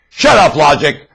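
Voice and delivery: loud shouted command; mock yell. Preacher voicing sellers yelling at logic, a loud shout. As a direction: yell it like they do. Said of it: "That's what they're yelling".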